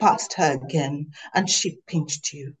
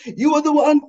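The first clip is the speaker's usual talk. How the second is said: yelling back; 10 semitones higher, 6 dB louder, faster than usual. Acted. Heated exchange in the pool.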